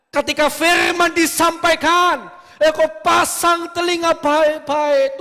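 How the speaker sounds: high, loud, urgent sermon call; full-force preaching. Acted. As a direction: drive the charge home to the congregation. (khotbah berapi-api, tinggi dan keras)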